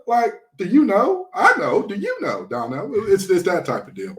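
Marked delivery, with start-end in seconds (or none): 0.6-2.9 mock North Texas accent, high with upward endings; a hammed-up Texas twang that lifts at the ends; bad Texas accent. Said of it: "pardon me for that terrible accent".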